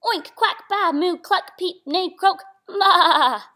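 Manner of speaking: high, loud run of animal calls; storybook farmyard chorus, ending on a big bleat; animal noises. A narrator's reading. All the animals sound off.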